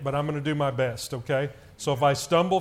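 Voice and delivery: ordinary talk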